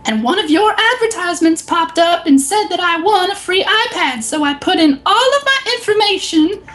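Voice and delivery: very high, quavering mock old lady; furious old lady. Retelling the Apple store story, a very high mock furious old lady. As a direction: squawk like an outraged old customer. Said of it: "And she's like".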